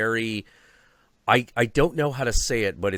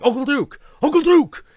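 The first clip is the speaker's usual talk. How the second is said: very high, loud excited calling; crowd calling out; 10 dB louder than usual. Retold or imitated. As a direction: shout his name like star-struck fans.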